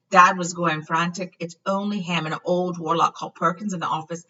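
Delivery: ordinary delivery, nothing marked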